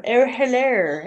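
slow, drawn-out posh English hello; mother-in-law's posh English hello; posh English accent. Retold or imitated. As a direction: put on a plummy posh English greeting like the Queen. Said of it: "because that's how the Queen would say it".

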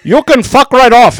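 slow, very loud, high-pitched retort; loud imitated fury; indignant mock outburst. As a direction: blow up like an outraged scammer, drawn out. Imitating the scammer's angry retort.

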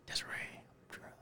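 quick, barely audible whisper; whispered confession. Retold or imitated. Drunk brother confessing to sister, quick tiny whisper.

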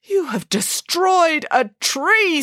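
put-on slow sorrowful rebuke to the boy; grave accusation. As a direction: accuse him slowly, full of grief. Grieving pig scolds boy.

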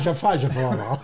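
mild Cleveland accent on nonsense words; a hint of nasally Cleveland accent. Shown as a demonstration. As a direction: rattle off gibberish with Cleveland vowels. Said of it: "That's my best Cleveland accent".

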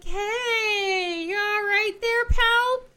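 high, loud, drawn-out mock check-in; acted concerned greeting. Heroine checks on queasy Christopher, high and exaggerated. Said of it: "And she's like".

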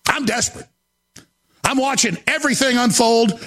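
usual speaking voice

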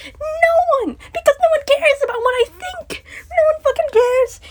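very high, tearful outburst; real anguished cry. Real, not acted. Venting that nobody will listen, anguished high wail. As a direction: cry it out in despair, pitch shooting up. Despairing outburst answering own question.

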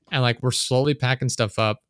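ordinary delivery

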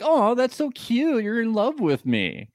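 high, slow, cooing mock girl voice; lightly voiced sugary woman; mock sweet voice. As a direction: coo it like a flattered date, high. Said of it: "she's just like".

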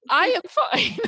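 high laughing reassurance. Real, not acted. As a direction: laugh it off brightly. Teary guest laughs it off.

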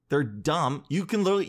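ordinary talk, nothing marked

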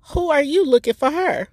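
lightly voiced old aunt, high and perky; a hint of a feisty elderly aunt; spunky auntie voice. Quoting auntie's text reply in her voice. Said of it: "the response was".

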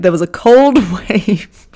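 laughing through the words; can't keep a straight face; breaking into giggles mid-line; giggling. Real, not acted. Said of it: "Fucking hell, pull yourself together".

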